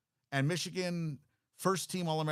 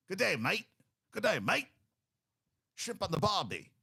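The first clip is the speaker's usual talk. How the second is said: higher-pitched, hammed-up Australian accent; terrible Australian accent; 7 semitones higher, 3 dB louder than usual. Host greeting an Australian punter, in a terrible Australian accent. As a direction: do a bad mock Aussie greeting. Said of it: "That's a terrible accent".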